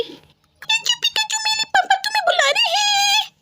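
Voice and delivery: very high squeaky calling voice; very high shouted child call; tiny child calling. Acted. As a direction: call across the yard like a small kid. Another child calls out.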